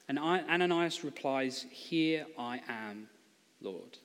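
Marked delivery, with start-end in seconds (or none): 1.7-4.0 slow, soft and humble; reverent reply. Acted. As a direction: answer the Lord with quiet devotion. Ananias answers Jesus reverently.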